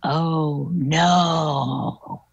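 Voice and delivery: put-on low, drawn-out ghostly old lady; old lady's voice. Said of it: "you heard this old lady's voice go".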